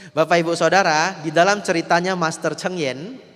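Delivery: ordinary talk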